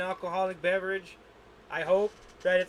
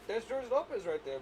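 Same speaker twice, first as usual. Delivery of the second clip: ordinary delivery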